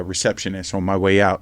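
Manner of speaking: ordinary talk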